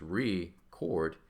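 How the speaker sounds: "record" slowly, as re and CORD; split into two syllables. Shown as a demonstration. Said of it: "There's our two syllables there".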